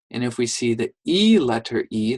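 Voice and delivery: ordinary delivery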